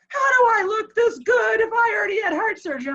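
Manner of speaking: high, fast incredulous question; retold astonishment. Patient marvels at himself after heart surgery, astonished. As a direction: blurt it out as the amazed patient.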